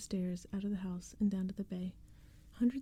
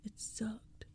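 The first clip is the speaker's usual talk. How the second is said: muttered; 6 dB quieter than usual. A narrator's reading. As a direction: grumble it quietly, sulking. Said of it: "Max muttered".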